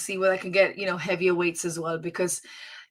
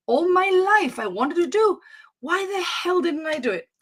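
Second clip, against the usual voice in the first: higher-pitched mock lament; imagined regret; 8 semitones higher than usual. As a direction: voice a pretend end-of-life regret. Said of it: "full of regret".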